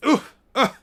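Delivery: ordinary delivery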